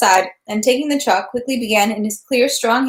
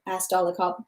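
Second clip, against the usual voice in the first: ordinary delivery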